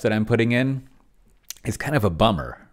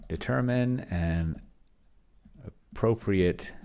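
slow, deliberate dictation of a prompt; measured dictating voice; 5 dB quieter, slower than usual. Shown as a demonstration. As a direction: spell it out slowly for the machine to take down.

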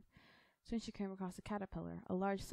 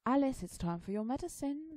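higher, louder rabbit voice; rabbit's announcement; 7 semitones higher, 7 dB louder than usual. Acted. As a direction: call it out as the rabbit.

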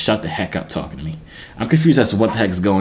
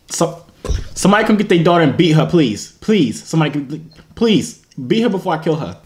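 high-pitched, fed-up pleading; exasperated mock plea; 7 semitones higher than usual. Mock plea over an annoying little girl.

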